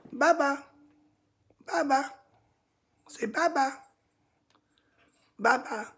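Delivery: pet teaching voice. Real, not acted. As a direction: coax the bird to repeat it. Repeating bye-bye for bird.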